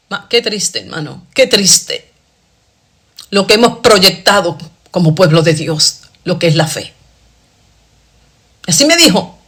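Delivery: ordinary talk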